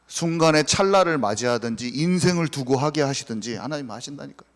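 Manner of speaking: ordinary delivery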